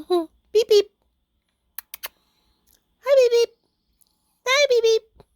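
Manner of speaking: very high playful beeps; squeaky pet greeting. Real, not acted. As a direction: squeak a hello to a little pet.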